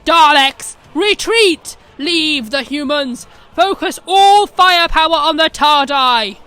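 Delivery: loud, high, slow Dalek battle cry; Dalek Emperor shouting. Acted. The Dalek Emperor ordering every Dalek over the speakers, shouting. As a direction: roar the orders to the whole fleet. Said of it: "the Emperor called out across every speaker".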